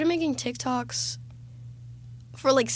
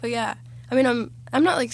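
ordinary talk, nothing marked

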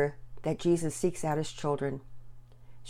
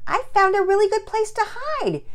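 put-on very high, loud child's voice; little girl's answer; 7 dB louder than usual. Found girl answering in the hug, high child voice. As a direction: pipe up like a small girl.

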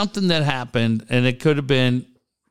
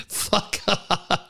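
breathy laugh through the words, softer; 5 semitones higher, 4 dB quieter than usual. Real, not acted. Cohost laughing off the host's teasing, laughing. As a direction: laugh it off, amused.

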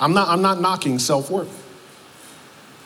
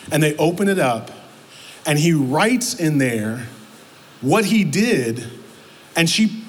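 normal talking voice

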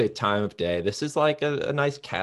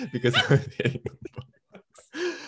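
laughing; 13 semitones higher than usual. Real, not acted. Laughs while reading.

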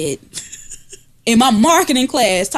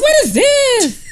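disgusted; 13 semitones higher, 3 dB louder, slower than usual. Retold or imitated. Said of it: "I was like".

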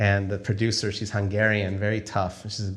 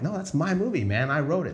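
much higher, protesting that the movie is his; indignant protest; 7 semitones higher than usual. Retold or imitated. To the producer, refusing to give up directing, indignant and high-pitched. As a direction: push back like it's your baby being taken.

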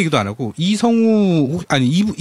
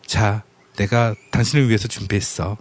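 tender husband; 6 semitones lower than usual. Acted. Husband stroking his wife's hands after kimchi-making, tender and hushed. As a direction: murmur it soothingly, voice sinking lower. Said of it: "손을, 손을 어루만지면서".

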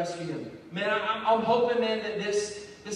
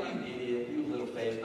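low, contemptuous put-down, lightly done; scornful rebuke; 12 semitones lower, 6 dB quieter than usual. Retold or imitated. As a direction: sneer the words as a mild mock insult. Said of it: "I don't think Jesus is looking at Peter like".

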